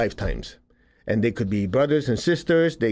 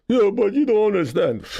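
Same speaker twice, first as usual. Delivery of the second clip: higher, louder mock whine; whiny objector; 8 semitones higher, 3 dB louder than usual. A listener protesting to the teacher, higher mock whine. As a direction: whine like someone who won't let go.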